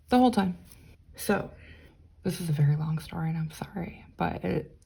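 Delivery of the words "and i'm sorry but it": sheepish whispered sorry; whispered apology. Real, not acted. Apologising to viewers for the long story, whispered.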